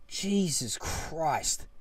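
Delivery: slow, soft exasperation; weary disbelief. Real, not acted. Fan recalling a snooze fest, wearily exasperated. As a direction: draw it out, worn down by the boredom.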